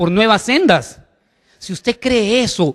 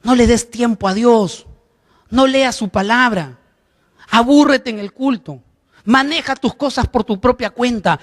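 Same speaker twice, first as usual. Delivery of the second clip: Satan's whisper; 3 semitones higher than usual. Retold or imitated. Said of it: "va a seguir susurrando".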